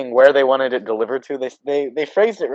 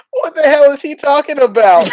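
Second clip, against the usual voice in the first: guy in background; 12 semitones higher, 5 dB louder than usual. Retold or imitated. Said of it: "a guy in the background go".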